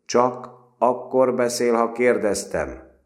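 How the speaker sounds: loud, slow, high rebuke; stern interrupting sergeant; cutting interruption. A narrator's reading. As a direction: cut the boy off. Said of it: "vágott közbe".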